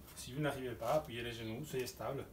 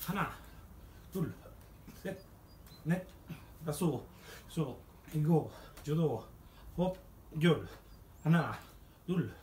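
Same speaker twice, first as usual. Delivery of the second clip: coach counting; 3 semitones higher, 3 dB louder, slower than usual. Acted. Coach counting push-ups in Korean.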